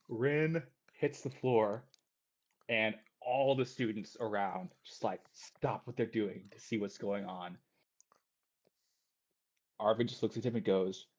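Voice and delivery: ordinary talk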